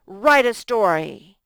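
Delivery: loud, bossy order; teacher barking a command for the kids. Shown as a demonstration. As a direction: boss the class into writing. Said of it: "Does that sound bossy?".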